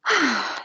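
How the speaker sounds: long, drawn-out impatient sigh. A narrator's reading. Small girl sighs at her parents' long talk, impatient. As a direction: let out a fed-up little sigh. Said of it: "She gave an impatient sigh".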